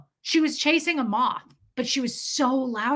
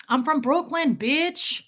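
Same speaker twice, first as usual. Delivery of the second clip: mock Brooklyn tough guy boast; swaggering New Yorker; 4 semitones higher, slower than usual. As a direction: imitate a cocky dude staking his turf. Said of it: "This dude said it so much".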